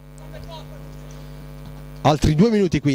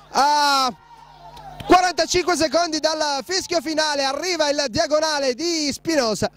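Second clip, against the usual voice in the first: breathless italian goal commentary; 8 semitones higher than usual. Real, not acted. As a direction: shout the goal, barely containing it. Goal scored late in match.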